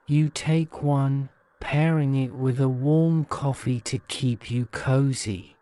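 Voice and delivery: ordinary delivery, nothing marked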